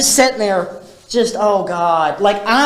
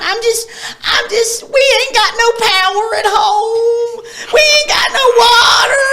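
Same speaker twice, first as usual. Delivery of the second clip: higher, louder imitated sobbing wail; crying mom; 3 dB louder than usual. Imitating mom crying to family about no water, mock sobbing wail. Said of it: "my mom would just be in the corner crying".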